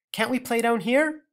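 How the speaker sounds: put-on high, pleading child; whiny girl. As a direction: beg like a child. Gretel pleading.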